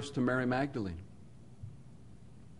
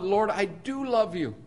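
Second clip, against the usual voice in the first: eager claim; 7 semitones higher than usual. Retold or imitated. Peter's eager, rash claim of love.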